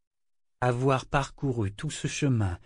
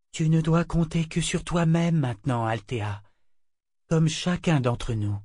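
ordinary delivery, nothing marked

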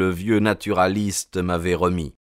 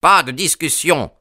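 high, louder yell; old Sherman yelling from his window; 8 semitones higher, 3 dB louder than usual. A narrator's reading. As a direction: cut him off with a shout. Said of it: "hurla monsieur Sherman".

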